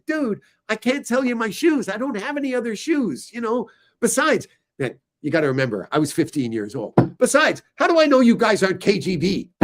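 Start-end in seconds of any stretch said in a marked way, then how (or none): 0.0-4.5 high, youthful retelling of own refusal; mock teen voice. Retelling his teen self refusing. 7.2-9.5 high, louder blurted question; mock accusing teen. Teen self accuses waiters.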